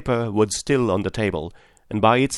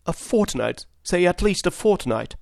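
pleading; 9 semitones higher than usual. A narrator's reading. Butler begging for more time.

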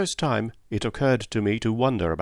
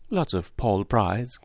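usual speaking voice

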